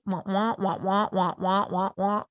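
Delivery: droning wordless "wah wah" voice; Charlie Brown's teacher. Acted. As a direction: imitate unintelligible muffled speech, syllable by syllable. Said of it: "you sound like Charlie Brown's teacher".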